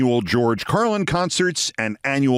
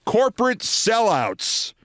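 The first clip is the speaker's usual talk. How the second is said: sneering quote; 8 semitones higher, slower than usual. Retold or imitated.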